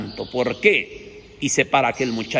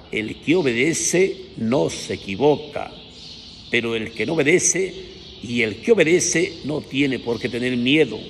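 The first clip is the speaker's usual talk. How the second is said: ordinary talk, nothing marked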